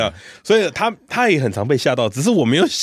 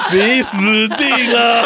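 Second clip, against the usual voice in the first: laughing doom; 8 semitones higher, 4 dB louder, much slower than usual. Real, not acted. Uncle mocks his own doom.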